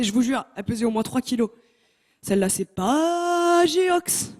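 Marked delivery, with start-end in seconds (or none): 2.2-4.3 high, slow, hammed-up advert voice; mock ad slogan. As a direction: sell it like a cheesy commercial. (slogan de pub parodié, aigu et lent)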